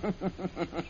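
quiet, mocking villain chuckle; soft villain laugh. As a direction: laugh off the threat softly. Villain scoffs at the threat.

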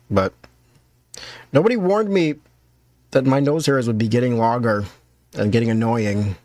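normal talking voice

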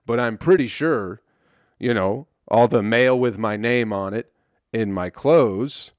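ordinary delivery

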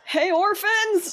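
high, carrying shout to allies; yelling out. Pretend. Player hailing allies mid-combat, yelling high. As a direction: holler it way up high down the street. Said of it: "you yell that out".

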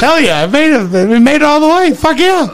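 rising, mock-triumphant cheer; a hammed-up victory whoop. Dad bragging he reached the bathroom, mock triumphant. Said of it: "And I'm like".

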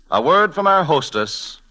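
ordinary talk

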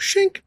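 high sharp shink sound; sword shink. Retold or imitated. Friend leaps from tree, mimicking sword swipe. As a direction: voice a blade slicing through.

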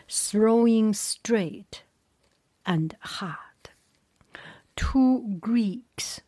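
loud, slow, emphatic battle narration; story narration. A narrator's reading. Reader telling how the chariots charged, emphatic story narration. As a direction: push out each phrase of the battle, then pause.

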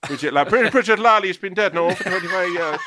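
high, loud retold exchange; landlady's voice. Retelling the exchange, raised voice.

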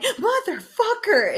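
high, drawn-out startled curse; startled yell. Retold or imitated. Telling how the stepmom scared her, startled curse. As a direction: blurt it out as if jumped from behind. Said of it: "And it scared me".

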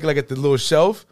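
usual speaking voice

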